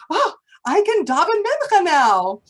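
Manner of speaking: a delighted exclamation; happy relief. Retold or imitated. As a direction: Zusha overjoyed he can pray again. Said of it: "he's all happy now".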